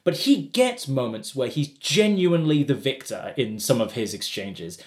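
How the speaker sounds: ordinary talk, nothing marked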